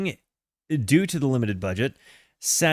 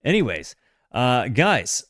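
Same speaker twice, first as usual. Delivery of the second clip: ordinary talk, nothing marked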